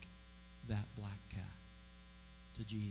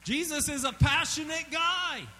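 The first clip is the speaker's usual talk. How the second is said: high, loud, excited declaration; excited shout; 13 semitones higher, 7 dB louder than usual. Real, not acted. Preacher shouting to the congregation, excited. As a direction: throw it out with a big grin.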